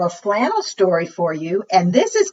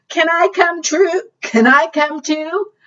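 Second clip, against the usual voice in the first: much higher, pleading frog; hopeful frog; 10 semitones higher, 3 dB louder, slower than usual. Acted. Frog on a rock begging to hop aboard, high and eager. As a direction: ask twice, eager.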